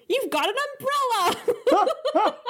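high, slow and laughing, stretching umbrella; gleeful. Retold or imitated. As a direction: quote her with giddy glee, giggling through it. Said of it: "the glee in which she said that".

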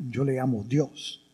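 ordinary talk

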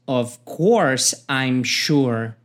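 very slow "Of course I'm sure"; slow teaching demo; slow model line. Shown as a demonstration. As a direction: drag each word for learners. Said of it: "o B diz".

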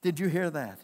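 ordinary delivery

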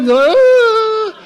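high, loud mock crying wail. Preacher mimics his crying face for the church, high loud mock wail. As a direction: ham up a sobbing face. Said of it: "my whole face is like".

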